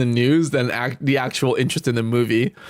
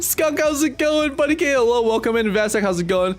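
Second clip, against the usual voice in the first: upbeat greeting; 8 semitones higher than usual. Real, not acted. Greeting chat viewers.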